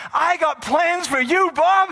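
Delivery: loud high cheerful declaration; voicing God. Preacher voicing God addressing Bob, high and loud. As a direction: announce it brightly. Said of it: "And he's saying".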